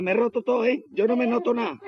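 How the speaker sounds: high, whiny mock complaint; lightly voiced injured worker, whining; voiced fallen man. Injured worker complaining to his rescuers, high whiny voice.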